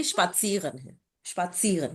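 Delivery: स्पात्सियरन softly, with ts in the middle, not z; pronunciation correction. Shown as a demonstration. Teacher correcting student who said z, soft careful model. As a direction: repeat स्पात्सियरन as "shpat-see-ren". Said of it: "इसको भी ज बोल".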